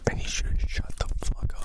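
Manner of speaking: laughing whisper of a lawyer; whispered lawyer's aside, barely holding back laughter. Pretend. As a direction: breathe it into his ear, laughing.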